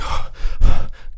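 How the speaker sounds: sharp loud gasp; panicked gasp. Retold or imitated. Friend waking from a trip, a sharp panicked gasp. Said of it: "woke up in panic".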